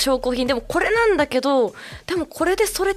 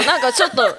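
ordinary delivery

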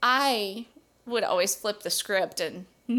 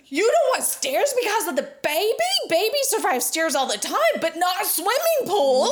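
raised, exasperated retold rant; yelling at TV; 6 semitones higher than usual. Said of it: "I'm yelling at the T V".

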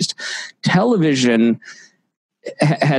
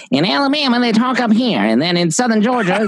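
put-on Alabama accent pitched much higher; Tim Wilson's Southern accents; 10 semitones higher than usual. Retelling a comedy bit on Southern accents, a high-pitched Alabama accent. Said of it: "he talks about co-, Southern accents".

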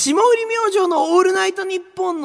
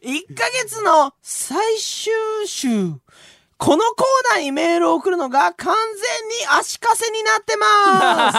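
slow dramatic reading; slower than usual. Acted.

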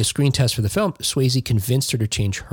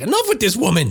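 mock Swayze; 8 semitones higher than usual. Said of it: "he was like".

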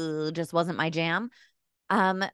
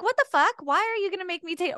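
shocked disbelief; 11 semitones higher, faster than usual. Retold or imitated. Shocked reaction in retold scene.

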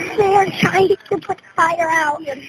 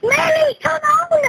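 high-pitched desperate shout to mother; urgent calling out; 8 semitones higher, slower than usual. Real, not acted. Child calls mommy out of the burning house, urgent shout.

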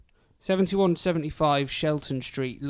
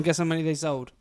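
normal talking voice

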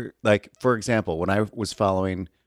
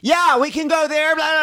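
much higher, loud brash phone voice; mocking Ramona; 10 dB louder than usual. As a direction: ham up a loud woman yakking on her phone. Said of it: "Talking on her phone".

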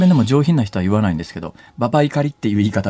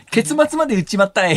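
comic confession; 10 semitones higher than usual. Acted. Comic confession of blurting a spoiler.

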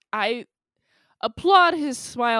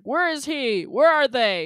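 a louder, demanding mock gangster; 5 semitones higher, 7 dB louder than usual. Gangsters hunting the guys in the hotel, mock demanding. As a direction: bark the questions like a thug. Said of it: "It's like".

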